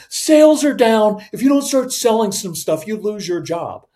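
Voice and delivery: loud, high-pitched alarmed threat; freaking out. Acted. Boss threatening the sales team, freaking out. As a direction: start high and loud, bearing down on them. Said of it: "we don't freak out".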